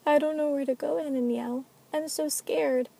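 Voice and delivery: put-on scared little girl. Little Kylan begging Ananiel for help, scared high voice. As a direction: plead like a lost, scared child. Frightened child in flashback.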